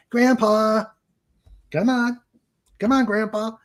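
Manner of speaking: a hint of an impatient child begging grandpa to come; pleading little kid. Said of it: "When you got a five-year-old saying".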